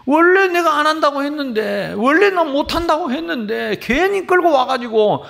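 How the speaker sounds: loud, slow whining protest to God; mock tantrum shout. Pastor throwing a tantrum at God, loud and whiny. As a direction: protest loudly like a sulking child. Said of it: "띵깡 나서요".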